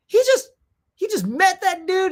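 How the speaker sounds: high, loud, breathless jealousy; jealous excitement. Retold or imitated. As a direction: squeal it out, green with envy. Jealous gasp at stranger.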